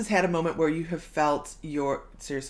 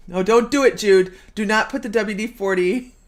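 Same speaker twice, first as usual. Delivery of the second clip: much louder, calling out in mock alarm; urgent warning; 4 semitones higher, 11 dB louder than usual. Host jokingly calling to Jude to stop, loud. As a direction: shout a warning across the room.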